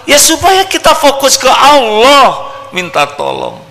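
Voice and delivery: high, loud emphatic cry; fiery sermon line; forceful preaching. Real, not acted. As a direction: press the point home to the hall. Preacher drives home point.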